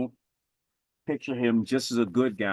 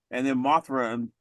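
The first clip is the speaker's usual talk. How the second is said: normal talking voice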